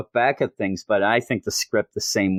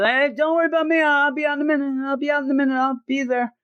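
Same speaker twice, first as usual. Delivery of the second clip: high, airy, oblivious chatter; high breezy carefree rambling; dazed Kirk voice; 13 semitones higher, faster than usual. Retold or imitated. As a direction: play a spaced-out guy wandering off. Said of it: "That's how he's going around".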